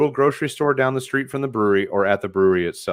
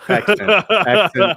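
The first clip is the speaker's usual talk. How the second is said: mock Fargo accent; 10 semitones higher, 6 dB louder than usual. Host jokes about the Fargo "accident", saying "accident" high and loud in mock accent. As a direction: tease the word "accident" in a playful, high Fargo voice. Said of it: "The Fargo accident".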